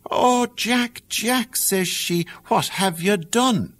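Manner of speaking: put-on the old mother fearing the worst; dismayed mother.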